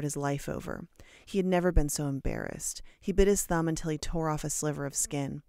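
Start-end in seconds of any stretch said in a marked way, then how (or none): none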